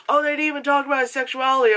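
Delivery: rushed mimicked grumble; mock complaining. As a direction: imitate people griping online. Mimicking online backlash complaints.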